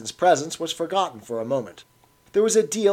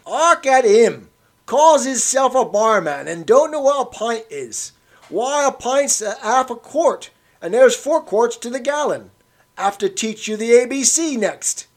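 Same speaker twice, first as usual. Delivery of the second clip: put-on much higher, louder, slow old Cockney; scornful old Cockney; 10 semitones higher, 8 dB louder than usual. A narrator's reading. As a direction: lecture the barman like a child. Old man mocking the barman.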